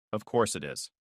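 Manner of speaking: low, quiet muttering under breath; muttered. Acted. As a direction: mutter it to yourself. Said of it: "I muttered".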